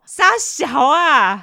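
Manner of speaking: high, loud, very slow disgust; appalled groaning cry; disgusted drawl. Real, not acted. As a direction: stretch it out in disbelief. Appalled reaction.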